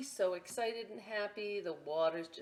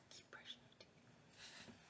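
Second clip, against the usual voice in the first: whisper; 20 dB quieter, slower than usual. Real, not acted. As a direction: let them in on a secret. Aside to children about pirate teeth.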